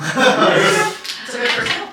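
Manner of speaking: slow words through a high laugh. Real, not acted. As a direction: laugh it out, amused. Laughing at the mercy kill.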